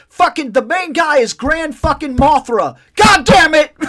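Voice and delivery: loud, high shout cracking into a laugh; furious retold shout. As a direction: yell it like you just blew the name in an argument. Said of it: "I was so pissed".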